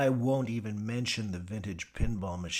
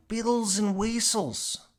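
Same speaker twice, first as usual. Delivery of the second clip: put-on high, louder "weasels" in her accent; mock Gadsby; 14 semitones higher, 7 dB louder, slower than usual. Mimicking Gadsby's accent, high and loud. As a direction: parrot it back mockingly. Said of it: "mimics the misheard phrase".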